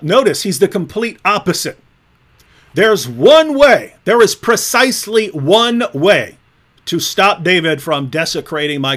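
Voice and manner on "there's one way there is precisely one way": slow, loud, stressed "one way"; slow punchy emphasis; emphatic. Real, not acted. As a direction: hammer the words "one way". Emphatic point about opponent.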